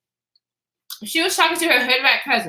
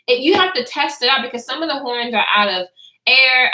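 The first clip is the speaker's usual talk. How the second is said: usual speaking voice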